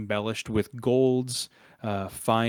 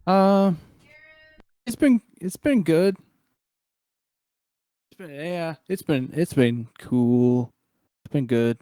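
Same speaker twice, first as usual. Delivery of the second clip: put-on higher, louder awkward boy voice; hesitant kid answering a grown-up, pitched up; shy young ward; 6 semitones higher, 8 dB louder than usual. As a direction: stammer it out in a raised boy's voice. Voices shy boy hiding behind guardian.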